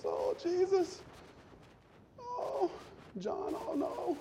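high, soft, keening cry of grief; mock wailing women. Preacher voicing women weeping at the cross, high mock wail. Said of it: "the women are at the foot of the cross".

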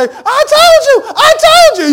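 very high, loud, slow gloating shout; hammed-up victory cry; mock triumphant shout. The vindicated mother gloating on the show, high shout. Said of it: "they say".

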